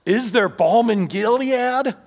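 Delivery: high, pleading cry. Retold or imitated. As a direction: lift the question up like a cry of anguish. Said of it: "he cries out".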